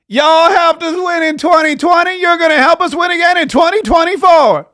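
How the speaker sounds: high, loud, hammed-up street accent; mock street accent. Host mocks a candidate's rally speech in a street accent. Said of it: "Kamala Harris became street".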